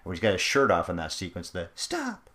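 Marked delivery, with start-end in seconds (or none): 1.7-2.3 high, light mock protest; falsetto mimicry. Podcaster mimicking a line from the film scene, high mock protest. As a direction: squeak it out far above your own voice.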